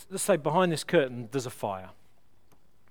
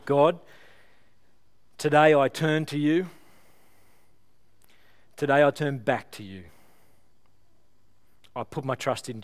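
led prayer. Real, not acted. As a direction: lead the congregation in prayer, each line for them to repeat. Leads a prayer of repentance.

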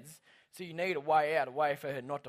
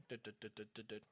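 low, very soft "dut" clicks; imitated winding noise; 6 semitones lower, 18 dB quieter, faster than usual. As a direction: mimic a crank ticking under your voice. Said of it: "I wound it up and went".

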